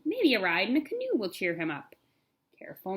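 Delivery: usual speaking voice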